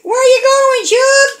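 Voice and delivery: put-on high baby voice, searching; voicing the baby. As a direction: speak for the baby, high and puzzled. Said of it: "She's like".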